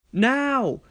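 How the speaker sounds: spring into the plan; eager cry. Acted. Thomas to himself, seizing his chance, eager cry.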